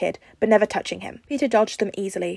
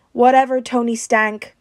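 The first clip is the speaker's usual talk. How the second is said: teasing; 4 semitones higher, 7 dB louder, slower than usual. A narrator's reading. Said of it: "Rhodey grinned back".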